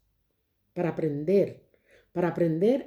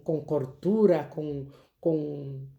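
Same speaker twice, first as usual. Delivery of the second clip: usual speaking voice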